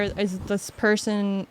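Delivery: ordinary talk, nothing marked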